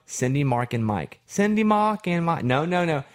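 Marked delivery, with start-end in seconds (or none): 1.3-2.4 higher mock Australian, r's dropped; Nikita's Australian accent. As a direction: repeat it stubbornly in an Australian accent. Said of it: "she couldn't say Cindy, Mark, and Mike".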